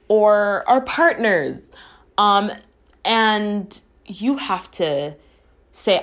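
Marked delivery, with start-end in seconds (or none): none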